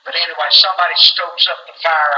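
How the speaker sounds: normal talking voice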